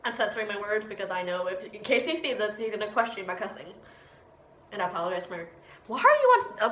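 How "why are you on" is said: startled outburst. Real, not acted. Sudden interruption while filming.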